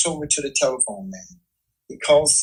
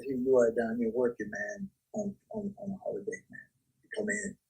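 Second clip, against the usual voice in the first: much softer, trailing under the breath; too quiet; 3 semitones lower, 7 dB quieter than usual. Real, not acted. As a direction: drop the level as if talking to yourself. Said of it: "you gotta talk louder".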